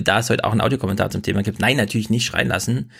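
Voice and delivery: ordinary delivery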